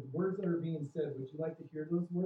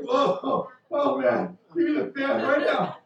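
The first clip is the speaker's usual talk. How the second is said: higher and louder, hammed-up flustered cry; mock flustered; 10 semitones higher, 12 dB louder, slower than usual. Pastor acting out a listener getting hot under preaching, mock flustered cry. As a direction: play it like the sermon is making you sweat.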